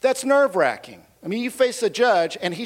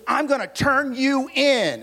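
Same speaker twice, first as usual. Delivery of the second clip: higher, slow threat; threatening neighbor; 6 semitones higher, slower than usual. Retold or imitated. Neighbor threatening to report him, slow and higher. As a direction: draw out the threat. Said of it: "She goes".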